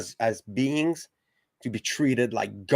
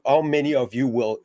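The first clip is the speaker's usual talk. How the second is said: ordinary talk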